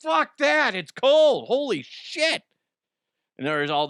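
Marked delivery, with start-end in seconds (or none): 0.0-2.4 high, loud yelp of shock; shocked yelp at cold water. Retold or imitated. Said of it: "and I'm like".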